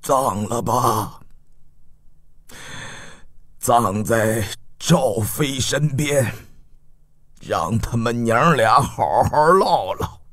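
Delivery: low, slow, soft husky grief; hoarse, grieving old father. A narrator's reading. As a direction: give burial orders with a hoarse throat. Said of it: "哑着嗓子道".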